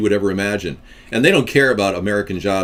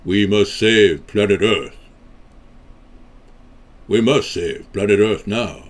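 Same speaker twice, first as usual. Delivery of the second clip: slow, lower, solemn put-on voice; 4 semitones lower, slower than usual.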